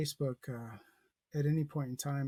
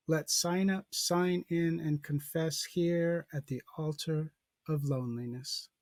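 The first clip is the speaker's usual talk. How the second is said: slow, measured poetry reading, a little louder; poem recitation; 2 semitones higher, 4 dB louder, slower than usual. Real, not acted. Teacher reads a sonnet to the class, measured recitation. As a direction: read it out deliberately, pausing after each line.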